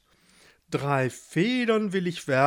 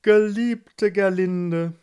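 slow, raised, solemn address; old king; 5 semitones higher, 6 dB louder, slower than usual. A narrator's reading. Old king addresses his late wife's painting, slow and solemn. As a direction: draw it out mournfully, a hint of old king. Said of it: "und sprach".

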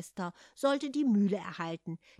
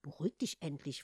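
softer, lower, calming; 4 semitones lower, 5 dB quieter than usual. Acted. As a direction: settle him down gently. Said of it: "versuchte seine Schwester, ihn milder zu stimmen".

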